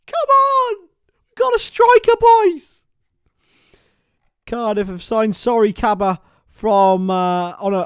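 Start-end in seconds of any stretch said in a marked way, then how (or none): 0.1-2.8 high, loud, slowed shout of joy at the news; excited shout. Real, not acted. Streamer shouting to his viewers about a Cardiff signing, overjoyed. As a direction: yell it out, thrilled by the signing. Cheering the new striker.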